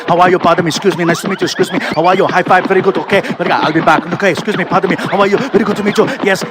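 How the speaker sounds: put-on rapid-fire Austrian-accented greetings, loud; Arnold Schwarzenegger. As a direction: rattle through the fan line as an Austrian star. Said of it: "Arnold's just like".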